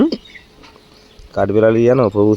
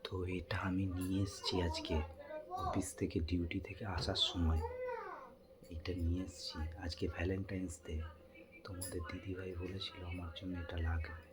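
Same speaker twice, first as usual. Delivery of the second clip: secret whisper, much softer; 2 semitones lower, 23 dB quieter than usual. Real, not acted. Husband secretly whispers to viewers about the hidden gift. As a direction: hush it so the wife won't hear.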